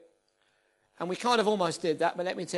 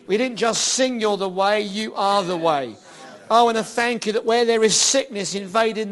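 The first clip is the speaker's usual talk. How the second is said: preacher praying over the room, building loud then softening; fervent prayer; 8 dB louder than usual. Acted. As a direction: pray over them, rising to full force. Prays over congregation, rising to loud.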